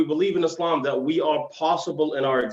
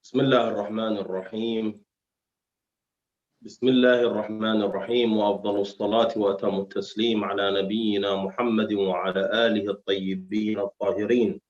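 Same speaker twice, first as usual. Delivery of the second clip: Arabic invocation; 3 semitones lower, much faster than usual. Acted. Opening Arabic invocation, fast.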